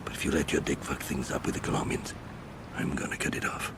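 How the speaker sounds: soft, menacing whisper; whispered threat. Acted. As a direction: lean in and threaten under your breath. Quiet threat to his brother.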